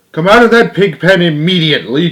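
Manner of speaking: high, loud stern order; crying out sharply. A narrator's reading. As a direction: call the boy out firmly. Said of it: "cried Missus Arabelle".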